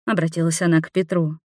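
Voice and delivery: ordinary delivery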